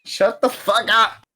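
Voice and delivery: high, loud yell; laughing shout. Real, not acted. Player yells at friend who keeps teasing.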